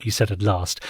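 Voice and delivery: plain reading voice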